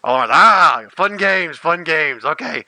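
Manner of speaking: loud, high, jolly drinking host; boisterous drunk host. Acted. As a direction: drunk and merry, hosting the round. Olimar, drunk and jolly, offers shots.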